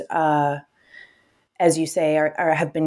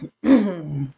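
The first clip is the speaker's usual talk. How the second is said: embarrassed laugh. Real, not acted. Laughing after choking on something.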